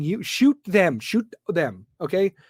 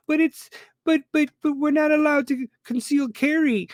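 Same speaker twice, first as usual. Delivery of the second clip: high whiny mocking voice of a complainer; mock whiny objector; 9 semitones higher than usual. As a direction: mimic a whining objector, pitched up. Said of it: "mocking objector's whiny voice".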